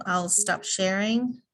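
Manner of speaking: ordinary talk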